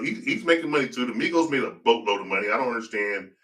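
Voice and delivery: ordinary talk, nothing marked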